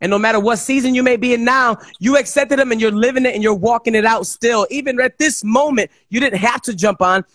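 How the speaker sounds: ordinary delivery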